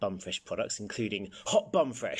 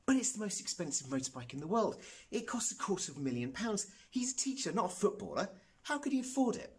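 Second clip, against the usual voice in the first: put-on higher-pitched, soft-edged boy's protest; astonished, climbing questioning; incredulous proclaiming; 10 semitones higher, 4 dB quieter than usual. A narrator's reading. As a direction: protest in high-pitched disbelief. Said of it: "proclaimed Joe".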